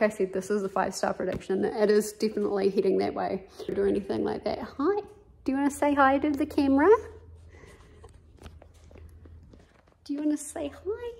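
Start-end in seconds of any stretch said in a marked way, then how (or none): 4.6-6.9 higher, cooing pet voice for the cat. Real, not acted. Talking to her cat. 10.0-11.1 higher and much softer coo with a rising end; soft cooing to cat. Real, not acted. Asking the cat again.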